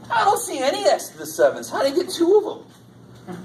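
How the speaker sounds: high-pitched, quick, incredulous questioning; mock disbelief. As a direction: act amazed at an impossible math miracle. Acting out an incredulous math miracle.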